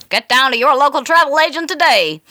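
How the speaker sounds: loud, high, brassy vintage ad read; old radio announcer. Retold or imitated. Bloke with a smoke selling travel, big old-time ad voice. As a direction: sell it like a fifties radio pitchman. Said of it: "bloke with a smoke in ... going".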